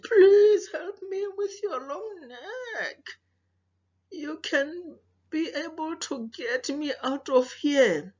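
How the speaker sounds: high, slow begging lion voice; pleading lion. Acted. Lion begging the animals to free him, high and pleading. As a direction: cry out for help as the trapped lion.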